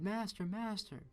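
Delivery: a magic harp crying for its master; high piping cry of master master. A narrator's reading. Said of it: "the harp cried".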